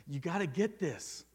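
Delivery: put-on a child begging parents to understand; desperate plea. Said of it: "it was like this desperation".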